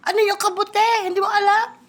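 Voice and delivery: ordinary delivery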